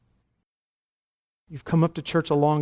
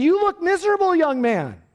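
put-on high, loud call at the young man; single him out loudly from the pulpit; preacher pointing at a young man in the gallery; 13 semitones higher, 4 dB louder than usual. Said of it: "and he said".

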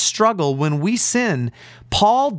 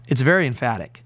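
ordinary delivery

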